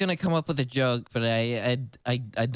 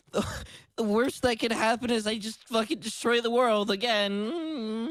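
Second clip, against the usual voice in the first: mock despair; 8 semitones higher than usual. Mock despair over new world.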